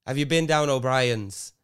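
put-on Irish lilt; hesitant attempt at an Irish accent. Shown as a demonstration. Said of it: "how do you do the Irish".

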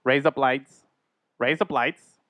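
"Raise up lights" tried as Aussie "razor blades"; Australian-sounding wordplay. Shown as a demonstration. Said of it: "you have to say raise up lights".

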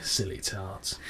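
low, hushed put-down; Statham-esque whisper. Retold or imitated. Podcaster quoting how Regal delivers it, hushed and low. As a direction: sink it down to a soft near-whisper. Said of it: "almost like a Statham-esque whisper".

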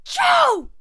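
loud, high acted sneeze; a huge cartoon sneeze; the lion sneezing the fly out, loud and high; big sneeze.

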